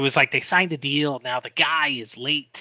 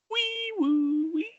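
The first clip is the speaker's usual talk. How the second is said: high siren wee woo; siren imitation; 5 dB quieter, slower than usual. Siren again, slower.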